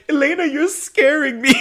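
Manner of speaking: very high, hammed-up tearful plea; almost crying. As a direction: imitate a freaked-out friend on the verge of tears. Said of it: "She's almost crying".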